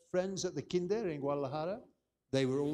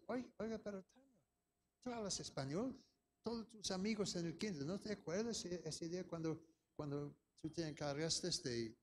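coaxing parent; 2 semitones higher, 8 dB quieter than usual. Retold or imitated. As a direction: gently reason with a small child. Parent coaxing daughter in Spanish.

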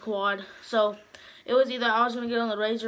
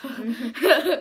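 loud laugh; 3 semitones higher, 8 dB louder than usual. Real, not acted. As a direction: burst of loud real laughter. Laughs at near-death joke.

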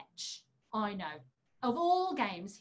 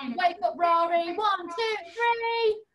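calling out; 5 semitones higher, 6 dB louder, slower than usual. Acted. Calling puppet awake with countdown.

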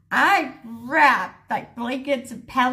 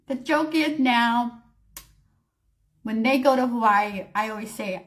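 ordinary delivery, nothing marked

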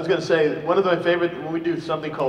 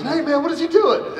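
high, startled outburst; shocked what-is-he-doing; surprised; 13 semitones higher, 5 dB louder than usual. Retold or imitated. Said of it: "He goes".

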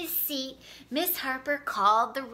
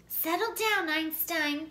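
stern; 5 semitones higher than usual. A narrator's reading. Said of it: "the girl said sternly".

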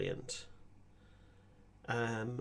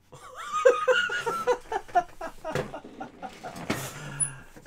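long high falling laugh. Real, not acted. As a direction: burst out laughing at the absurd ending. Laughing at confusing story.

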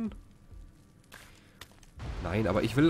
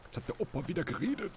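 higher, quicker self-mocking grandpa; 6 semitones higher, 4 dB quieter, faster than usual. Gamer mocking his own rambling, mock grandpa voice. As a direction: lightly put on a little grandpa voice.

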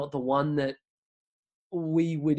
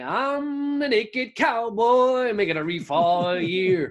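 high, slow, hammed-up American accent; terrible American accent; 9 semitones higher, 4 dB louder, much slower than usual. Australian podcaster mimicking the Times Square busker's song in a deliberately bad American accent. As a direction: belt it out in a cheesy fake American accent like a street busker. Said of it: "That's a terrible American accent".

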